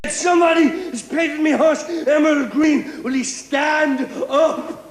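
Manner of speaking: high, loud outraged demand, slowing on the challenge; angry shout. Retold or imitated. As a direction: milkman storming back into the bar. Milkman furious about his horse.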